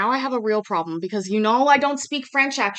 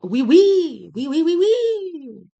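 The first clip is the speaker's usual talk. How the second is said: playful high oui oui with a laugh; cartoon French chatter; mock French oui; 6 semitones higher, 7 dB louder, slower than usual. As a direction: ham up a French yes, giggling.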